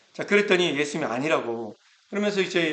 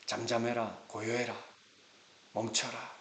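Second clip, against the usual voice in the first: softer, calm hushing; soft stilling command; 2 semitones lower, 9 dB quieter than usual. Acted. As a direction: hush the sea gently. Jesus calms the storm. (폭풍을 잠재우는 부드럽고 조용한 명령)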